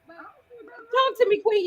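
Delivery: teasing. Real, not acted. Teasing a cohost to explain.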